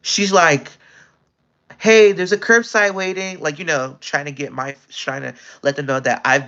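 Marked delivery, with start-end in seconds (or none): 1.8-3.4 calling out a bit louder, not a full shout; retelling a customer raising her voice to get attention; mildly raised call across a counter. Retold or imitated. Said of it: "she starts raising her voice".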